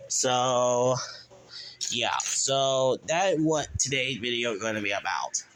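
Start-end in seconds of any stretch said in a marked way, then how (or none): none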